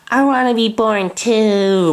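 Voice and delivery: put-on high, slow little boy plea; Michael's voice. Reader voicing Michael begging to be born too, high and slow. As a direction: plead like a small child left out. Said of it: "Michael said".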